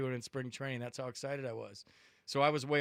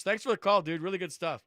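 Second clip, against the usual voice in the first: upbeat host thanks; 4 semitones higher, 7 dB louder than usual. Real, not acted. Host thanks caller loudly.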